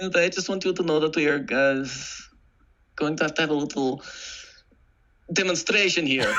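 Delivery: Russian accent. Shown as a demonstration. Reciting school-play lines for a friend, Russian accent. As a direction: deliver it as a hammy Russian constable. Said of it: "no one else did an accent".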